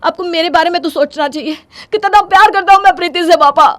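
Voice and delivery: distraught protest, rising to a loud high cry; panicked. Acted. Son confronting his father at the wedding venue, panicked. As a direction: build from shock to fast, loud pleading. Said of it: "घबराहट के मारे विपुल के पैर लड़खड़ा जाते हैं".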